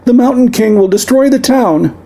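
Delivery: high, thin, desperate squeal. Acted. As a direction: squeal it in terror. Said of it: "desperate squeal".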